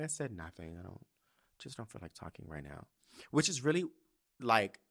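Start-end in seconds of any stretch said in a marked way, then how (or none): 0.3-2.9 very low, soft mumbled brush-off; retold reply, much quieter and lower, shutting down; withdrawn reply. Teen self putting off a concerned mom in the car, withdrawn. Retelling own sullen reply to mother.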